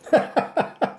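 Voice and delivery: short high-pitched laugh; amused laugh. Real, not acted. Host laughing at the cohost's promise to sparkle, amused. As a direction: burst out in a quick, high laugh.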